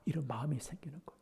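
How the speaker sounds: quiet confiding whisper; hushed whisper. Real, not acted. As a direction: lean in and breathe it out. Preacher drops to a hush.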